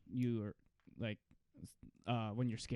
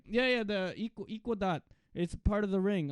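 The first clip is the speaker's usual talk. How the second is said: higher-pitched Filipino dad voice; Filipino accent; 7 semitones higher, 3 dB louder than usual. Retold or imitated. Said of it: "it's the Filipino way".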